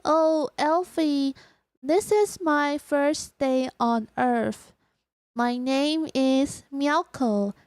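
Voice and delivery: very slow, deliberate English; careful, measured alien self-introduction; alien newcomer introducing herself. Pretend. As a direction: space out every word like a newcomer.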